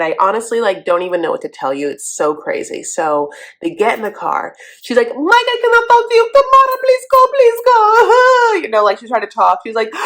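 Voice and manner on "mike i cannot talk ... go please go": much higher, louder pleading; hysterical mock Natalie. Host as Natalie begging Tamara to go. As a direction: beg in a high frantic voice.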